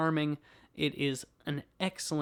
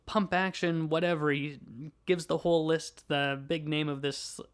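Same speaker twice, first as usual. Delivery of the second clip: usual speaking voice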